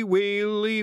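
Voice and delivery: hammed-up comic lament; drawn-out Scottish wail; wailing sob. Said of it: "he sobbed".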